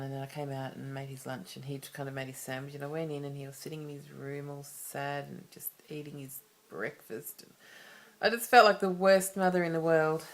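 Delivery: usual speaking voice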